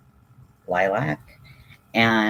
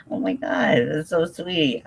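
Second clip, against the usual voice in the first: higher, touched "so sweet"; delighted, higher-pitched thanks; moved gushing; 8 semitones higher than usual. Real, not acted. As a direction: let the gratitude spill over. Still reacting to the gift.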